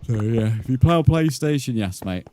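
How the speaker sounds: talking through a yawn. Real, not acted. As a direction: yawn while speaking. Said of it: "Can you stop yawning".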